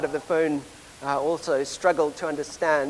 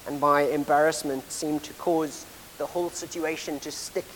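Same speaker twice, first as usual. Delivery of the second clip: ordinary talk, nothing marked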